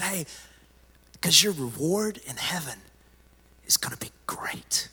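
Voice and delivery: soft, dropping to a whisper; secretive whisper. Acted. Preacher voicing Jesus revealing heaven's reward, whispered. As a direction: share it like a secret.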